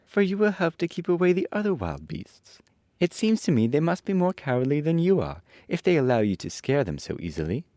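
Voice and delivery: put-on high girl character voice, easing downward; Dorothy. A narrator's reading. Dorothy reassuring the cowardly lion, high girlish voice. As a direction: start high as the young heroine. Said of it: "answered Dorothy".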